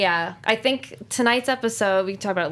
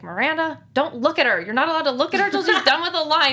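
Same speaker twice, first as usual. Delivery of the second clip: higher, louder stern telling-off; mock yelling director; 7 semitones higher, 4 dB louder than usual. Actress imitates director scolding cast like a dad, mock yell. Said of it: "like our dad was yelling at us".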